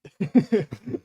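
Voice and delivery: loud amused laugh, falling away. Real, not acted. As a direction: crack up at the insult. Laughs after being insulted.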